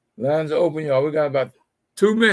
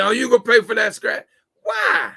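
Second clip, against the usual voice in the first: louder, fast, a mock nasty demand ending in a shout; nasty confrontation; 5 semitones higher, 6 dB louder than usual. To the car wash lady, a mock angry demand over a scratch. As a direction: play the petty customer picking a fight. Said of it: "I could have been nasty".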